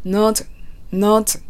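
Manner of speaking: "Not" ending in a hard, ts-like T; strong T in not. Shown as a demonstration. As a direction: punch out the T at the end of "Not". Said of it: "Tの発音が強くなっているので". (「Not」のTを強く出して)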